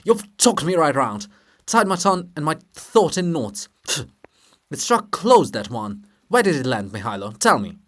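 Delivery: loud bristling demand. Acted. Shevchenko demands answers from Mihailo, loud and indignant. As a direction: press him hard, offended. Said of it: "still more indignant".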